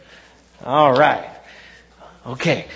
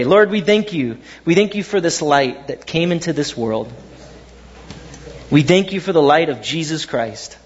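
prayer; 4 semitones lower than usual. Real, not acted. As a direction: pray humbly over the congregation. Pastor leads prayer.

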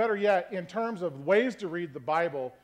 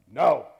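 short, loud NO; firm no; 3 semitones lower, 7 dB louder than usual. Shown as a demonstration. Pastor showing tones of no, loud firm NO. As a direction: put firm, loud force on NO. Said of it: "tone and inflection you say no".

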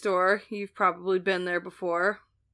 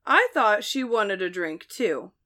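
usual speaking voice

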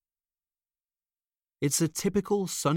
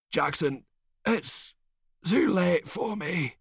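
slow, weak, high dying voice; fading last words; dying softly; 9 semitones higher, slower than usual. A narrator's reading. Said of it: "the dying man says softly".

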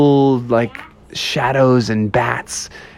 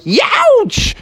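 very high, sudden yowch; pained yelp; 3 dB louder than usual. Acted. Bear pinched by a crab, high pained yelp.

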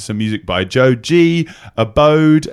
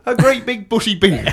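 put-on West Country accent; 10 semitones higher than usual. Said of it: "it comes from Hot Fuzz".

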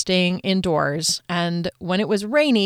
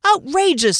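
high outraged exclamation; 9 semitones higher, 4 dB louder than usual. A narrator's reading. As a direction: scoff in outrage. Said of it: "said someone else".

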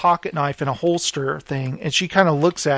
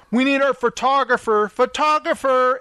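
calling out; 6 semitones higher than usual. Retold or imitated. Retold guy calls for photographer.

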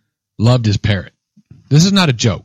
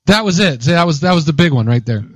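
usual speaking voice

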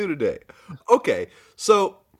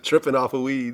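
normal talking voice

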